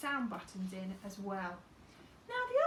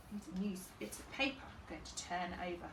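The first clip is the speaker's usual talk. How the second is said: usual speaking voice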